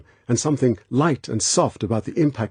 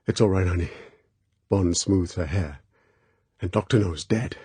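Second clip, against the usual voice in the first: soothing; 3 semitones lower, 4 dB quieter than usual. Acted. Bond soothing the sobbing girl.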